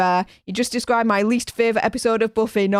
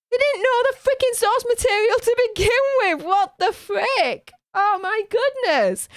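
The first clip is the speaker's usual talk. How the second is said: exasperated rant; 8 semitones higher than usual. Retold or imitated. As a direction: pitch climbing higher into an indignant outburst. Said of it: "I was like".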